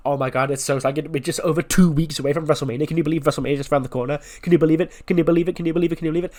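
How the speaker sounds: breathless-paced, repetitive fangirl gush; acted giddy excitement; excited gushing. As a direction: gush on and on without a gap. Gushing about WrestleMania in retold scene.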